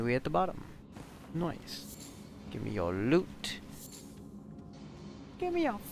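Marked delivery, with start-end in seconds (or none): 5.4-5.8 high, squeaky mock mugger voice; hammed-up stickup squeal; mock robber. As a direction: play a cartoon robber demanding cash. Mugger voice while looting.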